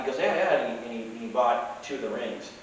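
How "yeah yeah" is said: quick imitation of pleased agreement, pitched up; mildly eager, higher-pitched quote; happy agreement. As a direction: play a happy buyer saying yes. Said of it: "He was all happy".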